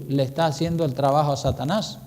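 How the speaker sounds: normal talking voice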